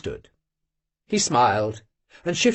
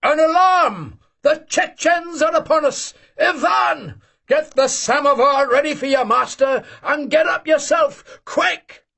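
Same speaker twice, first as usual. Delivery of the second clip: mock alarm shout; 10 semitones higher than usual. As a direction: rouse the sleepers as if under attack. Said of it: "cried the old man".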